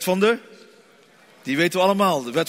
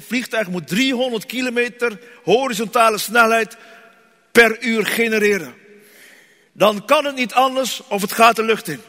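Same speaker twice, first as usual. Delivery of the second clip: preaching; 4 semitones higher, 4 dB louder than usual. Acted. Preaching climax, louder.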